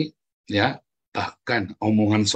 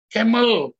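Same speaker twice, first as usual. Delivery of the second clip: put-on child's answer; 11 semitones higher, 5 dB louder than usual. Child answers teacher in story.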